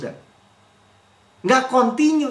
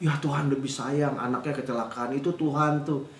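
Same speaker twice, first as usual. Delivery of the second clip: softer, lower sugar-coated piety; soft pious talk; 5 semitones lower, 9 dB quieter than usual. Retold or imitated. Churchgoers softening harsh theology, mock-gentle pious murmur. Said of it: "nggak kasar gitu".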